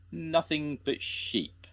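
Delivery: high, slow, cross; angry shout. A narrator's reading. Eli angry at the sheep, slow high grumble. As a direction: spit it out crossly. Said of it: "shouted Eli angrily".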